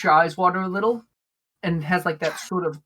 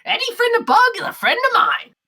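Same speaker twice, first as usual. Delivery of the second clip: high, loud kobold barkeep; hearty welcome in a high-pitched voice; welcoming bartender; 5 dB louder, faster than usual. Acted. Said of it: "He goes".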